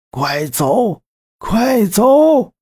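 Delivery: rising urgent shout to flee. Acted. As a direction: yell at them to get out. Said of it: "老奶奶突然大喊一声".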